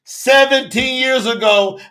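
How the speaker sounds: loud, rising preacher's call; fiery preaching. Real, not acted. Preacher shouting to his listeners about the well. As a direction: preach it out to the congregation, full force.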